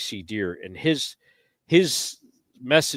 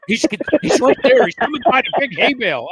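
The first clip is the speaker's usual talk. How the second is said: urgent warning; 7 semitones higher, 8 dB louder than usual. Retold or imitated. Frank's urgent warning retold.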